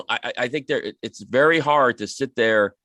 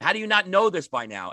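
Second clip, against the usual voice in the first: higher, hammed-up exasperated scolding; mock teacher yelling; 7 semitones higher than usual. Teacher mock-berating a student as a moron, higher and exasperated. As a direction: sneer it like a fed-up teacher. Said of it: "they don't start screaming at you".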